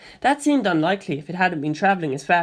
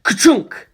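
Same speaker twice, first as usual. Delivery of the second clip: punchy louder mechanical clunk; projector clunk; 5 semitones higher, 7 dB louder than usual. A narrator's reading. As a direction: hit it like a slide changing. Projector sound effect.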